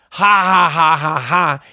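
deadpan, evenly spaced ha-ha; mock laugh. Reads friend's texted laughter aloud.

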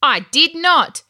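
high, loud, slow protest; shouted denial. A narrator's reading. Ben denies his sister's accusation, shouting. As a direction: deny it with force on each word. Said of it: "Ben shouted".

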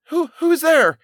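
put-on high stammering shout of a scared boy. A narrator's reading. Boy shouts at the ghost on the tower, high and loud. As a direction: call out sharply into the dark. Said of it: "he shouted".

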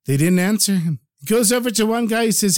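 usual speaking voice

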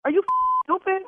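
furious. Real, not acted. Caller yelling at caller.

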